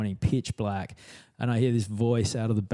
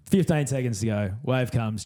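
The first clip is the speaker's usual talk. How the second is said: ordinary delivery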